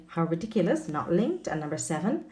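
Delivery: usual speaking voice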